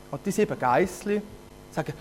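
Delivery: ordinary delivery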